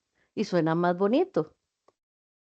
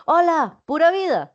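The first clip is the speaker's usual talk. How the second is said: bright, higher, louder "Hola, pura vida"; "pura vida" sung out as a happy hello; cheery greeting; 7 semitones higher, 3 dB louder than usual. Shown as a demonstration. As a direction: greet a friend with delight. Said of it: "aquí hablé de tres cosas diferentes".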